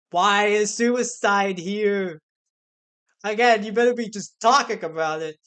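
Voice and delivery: loud, slow stunned question; alarmed shout. Real, not acted. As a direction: shout in disbelief at the screen. Alarmed reaction to the dialogue.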